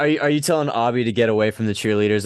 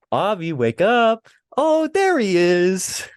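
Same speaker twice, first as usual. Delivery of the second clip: higher, slow coaxing call; mock wake-up singsong; 8 semitones higher, much slower than usual. Mimicking handlers waking the mascot, slow mock coo.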